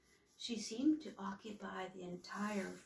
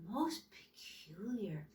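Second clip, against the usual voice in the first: thinking aloud; 6 dB quieter, slower than usual. A narrator's reading. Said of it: "thought Humboldt".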